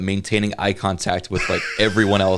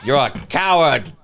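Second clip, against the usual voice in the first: mock Ollivander; 6 semitones higher than usual. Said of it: "S2 continues as Ollivander".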